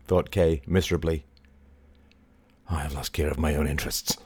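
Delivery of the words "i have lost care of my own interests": low, softer muttering; muttered. Acted. Lawrence mutters bitterly to his sister. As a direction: mutter it to yourself. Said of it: "muttered Lawrence".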